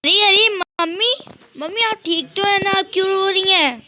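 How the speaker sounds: a daughter rushing in, anxious for her mother; worried daughter. Acted. Daughter worried about crying mother.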